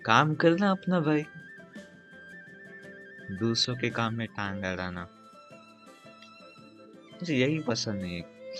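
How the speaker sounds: usual speaking voice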